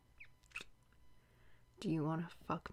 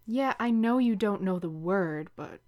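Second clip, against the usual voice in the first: ordinary talk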